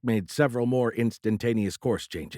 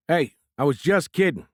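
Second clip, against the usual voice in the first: calling out, raised and high; loud protest; 7 semitones higher, 6 dB louder than usual. A narrator's reading. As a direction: protest loudly after him. Vic protests being left behind.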